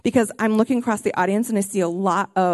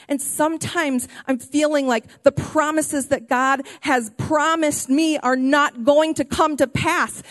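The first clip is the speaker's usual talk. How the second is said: ordinary delivery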